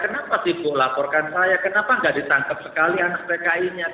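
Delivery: heated protest speeding up at the end; indignant outcry. Real, not acted. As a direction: demand an answer, furious. Indignant demand why arrest him.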